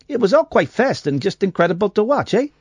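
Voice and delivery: high, lightly put-on Canadian accent with a tagged-on eh; a hint of a Canadian quoting the witness. Host reading a witness quote in a mock Canadian accent. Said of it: "that's a really good Canadian accent".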